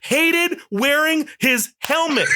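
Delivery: high, heated ranting, half laughing; furious rant. Real, not acted. Podcast host ranting to cohosts, furious and high. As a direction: vent pure outrage at the show. Ranting about Boba Fett helmet.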